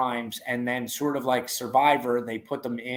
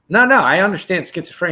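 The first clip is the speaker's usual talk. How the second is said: louder, quicker insistent retelling; eager retold reply; 6 semitones higher, 10 dB louder, faster than usual. Telling the inmate he gets schizophrenia, loud and quick. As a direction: brush it off fast and eager. Acted quote, reassuring the cellmate.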